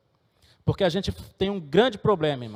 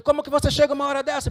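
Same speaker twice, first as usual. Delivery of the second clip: sharp, much higher and fast, a father scolding his late son; retold angry scolding; 10 semitones higher, faster than usual. Father scolding son who came home late, higher angry burst. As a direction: snap at him in a burst. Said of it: "eu falei".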